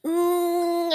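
high squeezed "mm" during a hug; happy hug hum. Retold or imitated. Daughter hugs a new friend, humming happily. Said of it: "gives her the biggest hug and goes".

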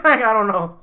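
ordinary talk, nothing marked

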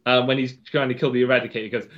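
ordinary talk, nothing marked

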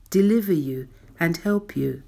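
normal talking voice

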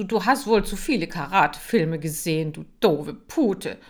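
loud, rising angry yell; angry shout. Acted. Marcel yelling at Kira to push her in the pond.

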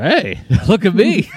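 high, loud mock showing off; Kickback. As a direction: crow it like a delighted show-off. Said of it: "he's like".

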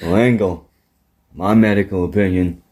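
low, drawled tough-guy growl; deep tough Texan. Retold or imitated. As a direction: play a gruff cowboy wrestler. Impersonating JBL-like Texan.

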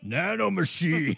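slow, drawn-out mock shout; hammed-up villain cry. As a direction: stretch it like a theatrical proclamation.

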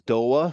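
"Doa" with the final R turned into an "ah"; dropped R. Shown as a demonstration. Explaining a childhood speech problem with R, dropped-R "Doa". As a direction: say "Doa", two syllables ending in "ah", no R. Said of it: "everything was O A H when it came to R".

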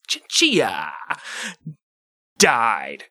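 slow solemn anchor read; news anchor voice. Acted. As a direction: relish the name like a newsreader. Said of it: "This is a fun word to say".

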